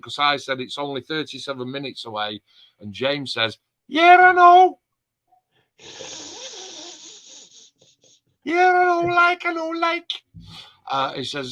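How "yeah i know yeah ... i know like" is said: much higher, louder hammed-up Scouse voice; Scouse accent. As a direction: mimic James in an exaggerated squeaky Scouse accent. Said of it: "in my finest Scouse accent".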